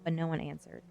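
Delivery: ordinary delivery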